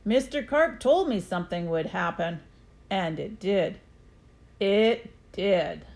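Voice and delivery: plain reading voice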